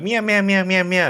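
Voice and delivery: high, squeaky cat meows; cat meowing. Pretend. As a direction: meow like a cat. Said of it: "We go like".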